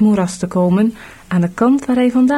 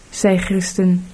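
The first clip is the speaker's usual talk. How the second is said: usual speaking voice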